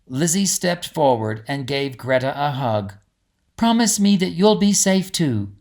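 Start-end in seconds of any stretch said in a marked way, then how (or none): 3.6-5.5 higher, louder, tender plea; a girl begging her friend to stay safe; pleading princess. A narrator's reading. Lizzie hugs Greta.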